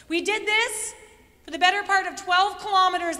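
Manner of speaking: ordinary delivery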